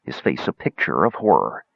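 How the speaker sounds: ordinary delivery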